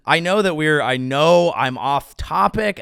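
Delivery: ordinary talk, nothing marked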